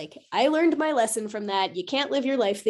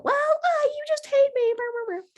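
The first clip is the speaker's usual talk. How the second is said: very high, squeaky mocking kid voice; mock daughter whine. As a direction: parody the sulking toddler's complaint. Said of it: "No, I didn't say that".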